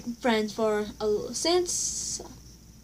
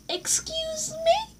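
very high, drawn-out excuse me; sassy shocked retort; indignant excuse me; slower than usual. Retold or imitated. Retelling her comeback to the classmate, high drawn-out sass.

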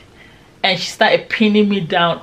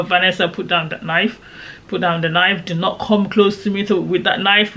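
plain reading voice

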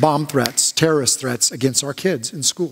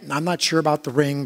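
ordinary delivery

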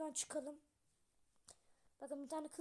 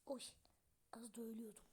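relieved whisper; 5 semitones lower, 8 dB quieter, faster than usual. Real, not acted. Nearly died in the game.

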